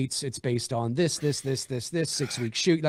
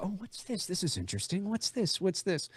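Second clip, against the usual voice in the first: intrigued onlookers; 5 semitones higher, 8 dB quieter than usual. Retold or imitated. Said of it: "to just keep the".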